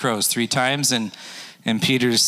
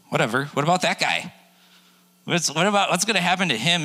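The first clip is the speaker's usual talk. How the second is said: pestering Peter; 7 semitones higher than usual. Retold or imitated. Peter pestering, higher pitch.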